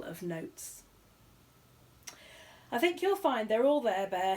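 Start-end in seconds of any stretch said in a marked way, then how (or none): 2.7-4.4 stiff, louder host voice; polite through clenched pride; host paying out, stiffly. A narrator's reading. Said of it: "he said stiffly".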